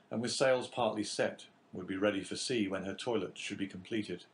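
usual speaking voice

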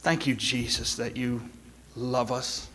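pastor's prayer. Acted. Leads closing prayer.

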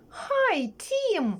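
very high, sing-song greeting; Tom. Acted. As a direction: squeal a friendly hi, stretched out. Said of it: "Що на це відповів Том?". (високе співуче привітання)

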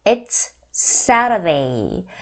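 It's Saturday with t flapped to r, saradei, slow and soft. Shown as a demonstration. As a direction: say Saturday American style, t like a light r. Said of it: "T 사운드를 Saturday 하고 되게 유연하게". (미국식 굴린 t로 새러데이)

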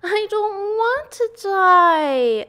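high, loud, drawn-out shriek; full scream. A narrator's reading. Pig throws himself to the ground, screaming. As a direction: scream it out, stretching the words. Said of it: "screamed Wilbur".

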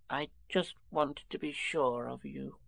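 soft, slow little Piglet; small tender voice. A narrator's reading. Piglet takes Pooh's paw, softly confiding. Piglet seeks reassurance from Pooh.

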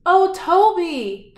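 high, loud, slow happy call; joyful greeting. Acted. Zebras greet their lost friend Toby, joyful. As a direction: welcome him home with delight. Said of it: "they said".

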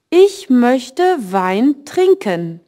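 slow, careful German read for learners; slow teacher demo reading; slow model sentence. Shown as a demonstration. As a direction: spell it out slowly for the class.

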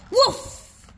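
high, loud yappy puppy barks. Acted. Reader barking as Biscuit the puppy, high and loud.

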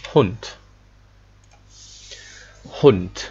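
the final D of Hund voiced as T. Shown as a demonstration. Teacher to class, showing final D sounds as T. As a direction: say Hund ending on a hard T, "Hunt". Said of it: "but pronounced as".

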